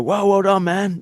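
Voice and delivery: high, bright mock admiration; impressed praise. Guys congratulating the speaker, impressed high voice. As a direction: play the impressed mate congratulating him.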